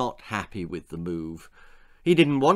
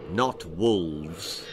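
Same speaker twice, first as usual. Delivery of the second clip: much lower menacing voice; snarl; 7 semitones lower than usual. A narrator's reading. Troll leader snarls at the villagers, snarling. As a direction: snarl it like a beast. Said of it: "snarled the leader".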